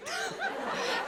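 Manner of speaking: high, soft burst of laughter. Real, not acted. Laughing during story.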